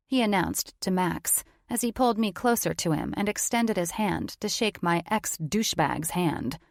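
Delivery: plain reading voice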